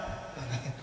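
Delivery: soft short chuckle, very quiet; demo laugh. Shown as a demonstration. Teacher demonstrates the patient's quiet laugh during ruqya. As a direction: show how the possessed one laughs. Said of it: "They laugh like that".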